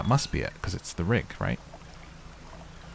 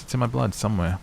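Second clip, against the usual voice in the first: normal talking voice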